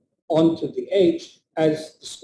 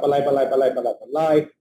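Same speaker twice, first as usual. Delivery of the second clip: ordinary delivery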